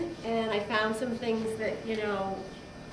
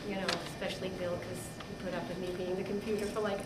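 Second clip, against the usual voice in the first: ordinary talk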